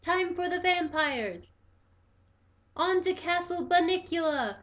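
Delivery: high, loud evil cry; vampire shout. A narrator's reading. Pete shouts to the gang, high and loud. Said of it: "Peter shouted".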